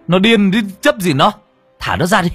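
indignant intervention. Acted. Bystander stopping a beating, loudly remonstrating. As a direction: cut in loudly to make her let go.

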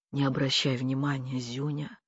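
low, soft, quick mutter. A narrator's reading. Uncle aside to nephew about aunt, muttering. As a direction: mutter it under your breath. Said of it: "пробормотал дядька".

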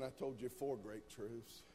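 normal talking voice

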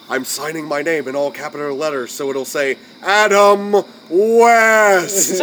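pompous announcing that builds to a huge drawn-out shout; Adam West. Acted. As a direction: declare it, then bellow the name very slowly. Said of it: "give us your Adam West".

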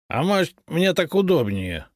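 a cornered tramp sniping defiantly at the policeman; snapping back. A narrator's reading. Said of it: "огрызнулся пьянчуга". (огрызаясь, с вызовом)

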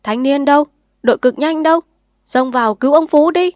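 put-on frightened old man shouting orders; panicked. A narrator's reading. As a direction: yell for help in a panic. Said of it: "Lão Bòng hốt hoảng".

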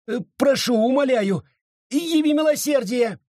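high pleading plea for mercy; a terrified man begging, voice up high. Acted. Terrified judge begs Abita for mercy, voice pitched high and pleading.

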